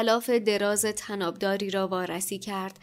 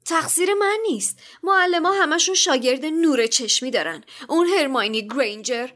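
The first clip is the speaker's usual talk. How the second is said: put-on high, loud protest of a boy; whiny, quick-tongued comeback in a raised pitch; cheeky retort; 7 semitones higher, 5 dB louder than usual. A narrator's reading. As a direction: talk back to father, blaming the teachers. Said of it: "دریکو با حاضر جوابی گفت".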